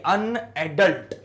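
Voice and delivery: अनएडल्ट drawn out slowly; slow sounding out. Shown as a demonstration. Teacher splitting the word for students, slowly. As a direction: stretch अनएडल्ट syllable by syllable. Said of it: "यहाँ तक तो सही है".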